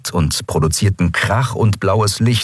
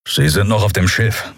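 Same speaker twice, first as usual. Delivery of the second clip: understated grumble; grumbling. A narrator's reading. Keru reporting where the others are, grumbling. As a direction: grumble the answer. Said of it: "brummte Keru".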